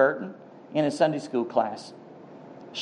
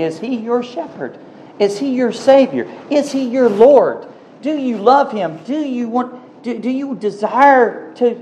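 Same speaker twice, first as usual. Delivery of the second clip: rising questions; 8 semitones higher than usual. Real, not acted. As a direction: press each question harder. Challenging the congregation.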